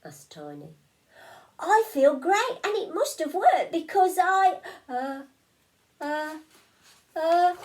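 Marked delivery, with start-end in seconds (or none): 1.6-7.6 squeaky sneezy fish. Acted.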